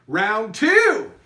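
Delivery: announcer round call. Pretend. Host to the online teams, loud announcer call. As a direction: round two called out loud. Calling end of round two.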